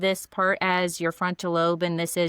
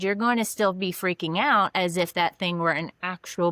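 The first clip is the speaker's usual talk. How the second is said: usual speaking voice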